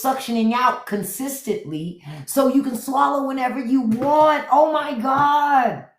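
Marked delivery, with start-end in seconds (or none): 2.3-5.9 high, slow, drawn-out awe; excited gush. Real, not acted. Geeking out over the new dentist tool, high and slow. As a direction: stretch it out high in delight.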